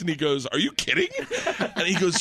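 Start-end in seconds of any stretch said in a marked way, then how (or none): 0.5-1.1 amazed disbelief. Retold or imitated. Friend reacting in disbelief.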